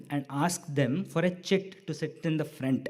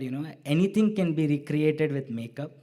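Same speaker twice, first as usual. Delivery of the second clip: ordinary talk, nothing marked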